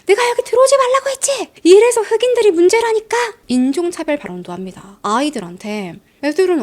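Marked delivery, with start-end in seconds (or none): 0.1-3.2 much higher, louder shrieking rant; furious yelling neighbor. Retold or imitated. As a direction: scream it like an enraged woman at the door. Acted racist neighbor yelling.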